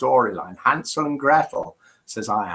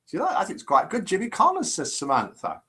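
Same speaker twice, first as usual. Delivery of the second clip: ordinary talk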